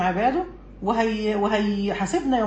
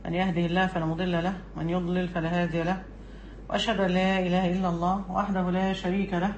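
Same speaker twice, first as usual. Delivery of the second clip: measured, softer formal recitation of the opening praise; formal sermon opening; 3 semitones lower, 5 dB quieter than usual. Acted.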